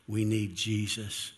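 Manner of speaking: ordinary talk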